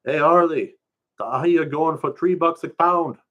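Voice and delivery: put-on high, loud island kid voice; a teen bursting with the news; excited kid. Kid tells Harley about ahi prices, high excited voice.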